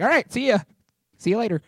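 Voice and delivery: high-pitched, casual goodbye; breezy send-off. Acted. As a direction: toss off a mock cheery farewell.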